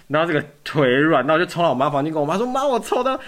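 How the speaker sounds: high, loud rush of joy telling mom; thrilled shout. Retold or imitated. Fan bursts into mom's room after winning, thrilled shout. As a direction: burst out with the news.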